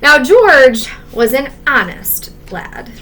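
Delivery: usual speaking voice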